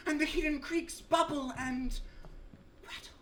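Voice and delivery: high declamation sinking to a hushed last word; faltering recitation. Acted. Recitation falters at unfortunate rhyme.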